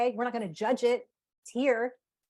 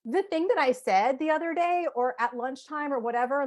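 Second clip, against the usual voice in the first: gentle apology; 5 semitones higher than usual. Retold or imitated. Parent starting an apology to her child, gentle and lighter. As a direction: open the repair kindly to the child. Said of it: "You can be like".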